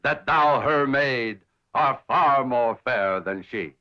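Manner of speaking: slow, declaimed romantic verse; Shakespeare love speech. Acted. Suitor reciting Shakespeare to an admirer, slow declamation. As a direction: recite it grandly, drawing out each phrase. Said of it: "love speeches from Shakespeare".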